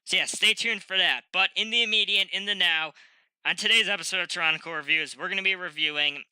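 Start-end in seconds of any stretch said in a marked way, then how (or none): none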